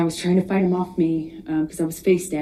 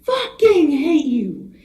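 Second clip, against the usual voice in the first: much higher, louder and slower, a recalled scream on the edge of crying; retold desperate scream; 9 semitones higher, 4 dB louder, slower than usual. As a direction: echo his desperate screaming, near tears. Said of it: "he was screaming over and over".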